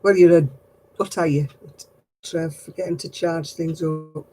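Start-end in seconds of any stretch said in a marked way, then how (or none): none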